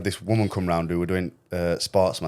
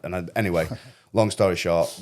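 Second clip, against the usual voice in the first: ordinary talk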